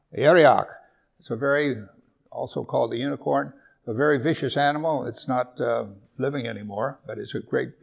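ordinary talk

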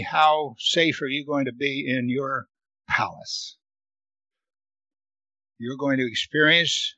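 ordinary talk, nothing marked

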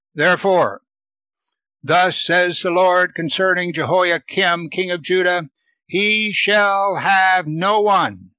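preacher reading scripture aloud. Acted. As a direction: read the passage out to the congregation.

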